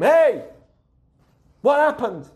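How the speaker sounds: high, loud demand to know; acted startled parent shout; parent calling out sharply. Parent storms in on fighting kids.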